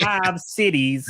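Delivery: slow Chicago guy voice; Cecil impression. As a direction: drag it out in a Chicago accent. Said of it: "I can't do a good Cecil".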